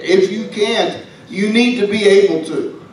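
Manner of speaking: normal talking voice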